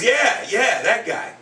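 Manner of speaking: put-on high, loud, eager kid; second-grade kid. Second grader confirms to teacher who he means, imitated excited kid. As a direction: imitate an excited little boy agreeing. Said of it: "one of my second grade kids".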